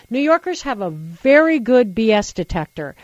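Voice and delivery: ordinary talk, nothing marked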